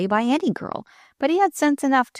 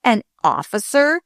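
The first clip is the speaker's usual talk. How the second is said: haughty, affronted question, louder and higher; scornful disbelief, raised in pitch; contemptuous; 5 semitones higher, 6 dB louder than usual. A narrator's reading. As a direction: throw it back with haughty contempt. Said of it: "he said with a contemptuous".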